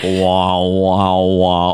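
low droning "wah, wah, wah"; imitated wah-wah sound. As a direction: mimic a low repeating sound effect. Imitating a sound effect heard.

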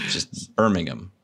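ordinary delivery

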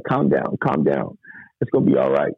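low, calming imitated voice; soothing reassurance. Driver reassuring the distraught young man, low soothing tone. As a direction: settle someone down with a low, steady voice.

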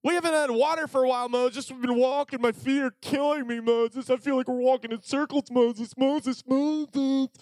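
high nagging whine; whiny complaining Israelites. Retold or imitated. Israelites complaining to Moses about water, high whiny nag. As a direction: whine at Moses like tired kids. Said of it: "they start complaining to Moses".

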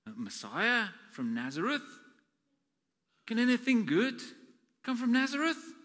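mock the idea of a Messiah from Nazareth; incredulous Nathanael. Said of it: "And Nathanael go".

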